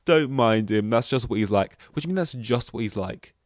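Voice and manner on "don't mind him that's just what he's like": loud fake cackle into a dismissal; hammed-up ha-ha, then brushing it aside; mock laughing. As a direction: imitate a companion laughing it off loudly. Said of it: "like, laughing".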